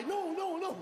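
frantic denial. Pretend. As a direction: deny it fast and high. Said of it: "Now you gotta lie".

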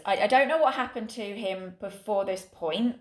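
ordinary talk

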